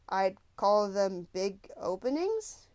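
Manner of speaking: slow, dry sarcastic question; deadpan it to hide unease. A narrator's reading. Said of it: "trying to counter his discomfort with sarcasm".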